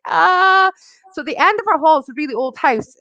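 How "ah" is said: high mock child's wail; little son's wail. Little son amid smoke and alarms, mock wail. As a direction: imitate a small child's alarmed cry. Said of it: "My poor little son is like".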